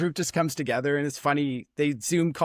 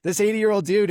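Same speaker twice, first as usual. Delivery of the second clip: usual speaking voice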